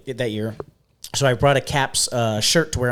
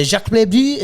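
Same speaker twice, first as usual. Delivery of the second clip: higher, louder mock French name; French accent; 9 semitones higher, 6 dB louder than usual. Giving his fake player name, showy French accent. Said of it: "I was like, uh".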